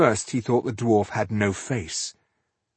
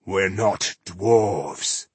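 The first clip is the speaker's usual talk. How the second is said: grave-deep voice; much slower than usual. Acted. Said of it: "a voice ... as deep as the grave".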